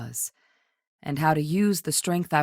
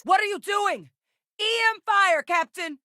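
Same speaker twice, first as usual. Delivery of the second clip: very high, urgent combat cry; alarmed shout. Acted. Companion warning captain about E M fire, alarmed shout.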